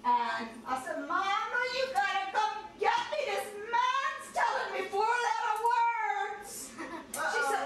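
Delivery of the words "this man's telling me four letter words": drawn-out whining; slow whiny complaint. Retold or imitated. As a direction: drag it out like a hurt bride. Bride complaining to mama.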